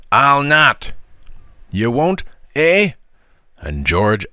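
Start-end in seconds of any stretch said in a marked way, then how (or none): 0.1-0.9 loud, high-pitched refusal; bratty raised-voice defiance; defiant refusal. A narrator's reading. Green refusing to apologise. 1.7-2.9 threatening comeback, pitched up; menacing challenge. A narrator's reading. George threatening defiant Green.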